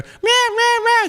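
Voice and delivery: high, mocking chant of rah; mock ranting crowd. Republicans yelling about deficits, high mock rah chant. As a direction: bark rah like a heckling mob. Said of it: "screaming about how".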